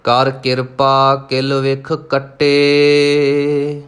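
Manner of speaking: slow recitation with long-held line endings; scripture recitation. Shown as a demonstration. Teacher reciting Gurbani for learners, slow and sustained. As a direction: recite steadily, drawing out each long vowel.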